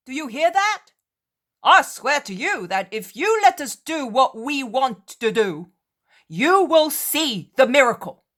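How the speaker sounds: high, loud insistent appeal; loud urgent plea. Acted. Disciple loudly promising the Cretan a miracle. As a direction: swear it to him at full voice. Disciple pleads over storm.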